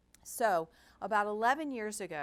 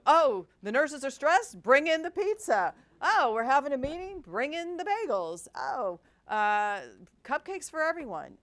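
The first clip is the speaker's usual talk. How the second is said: mock cheerful; 7 semitones higher, 9 dB louder than usual. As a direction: parody the eager pizza-for-everything reflex. Mocking old food-celebration habit.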